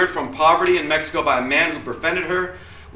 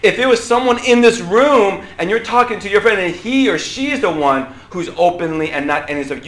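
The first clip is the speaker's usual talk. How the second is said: ordinary talk, nothing marked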